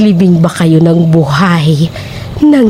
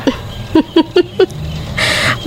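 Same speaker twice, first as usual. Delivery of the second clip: breathy sly laugh; 9 semitones higher, 6 dB quieter than usual. Acted. Landlady toying with frightened tenant.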